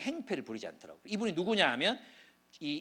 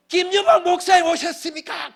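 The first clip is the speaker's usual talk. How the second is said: a high, loud joyful shout across the room; imitated patient shouting a happy welcome; booming greeting; 13 semitones higher, 15 dB louder than usual. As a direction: call out proudly so the whole ER hears. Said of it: "쩌렁쩌렁 울리게 막 소리를 질러요".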